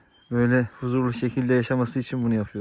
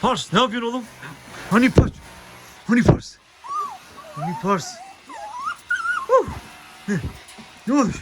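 much higher, playful voice for the dog; pet talk. Real, not acted. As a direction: coo at the puppy.

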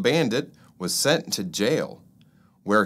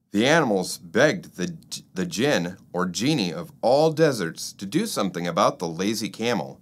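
ordinary delivery

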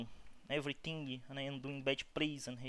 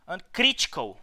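frustrated outburst; 6 semitones higher, 11 dB louder than usual. Real, not acted. Reacting to a battle crit.

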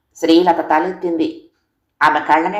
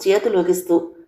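ordinary delivery

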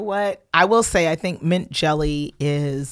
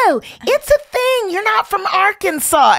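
much higher, laughing mock voice; 13 semitones higher than usual. Friend defending a recipe, much higher mocking voice. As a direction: squeak it like a defensive friend from Arkansas. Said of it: "'Cause they're like".

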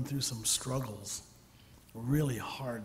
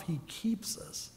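soft whisper; 3 semitones higher, 5 dB quieter, slower than usual. Real, not acted. Preacher hushes the key line.

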